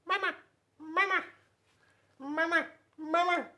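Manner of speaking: very high, loud gosling cries; baby geese calling. Acted. Baby geese greeting Bruce, very high and loud. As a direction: squeak like a tiny gosling. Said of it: "the baby geese kept saying".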